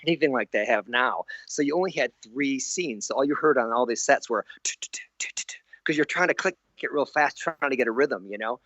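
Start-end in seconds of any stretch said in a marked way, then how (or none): 4.6-5.4 quick unvoiced t-t-t clicks; soft imitated camera clicks; whispered clicking. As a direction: tap out the frame grabber clicks under your breath. Imitating rapid frame clicking.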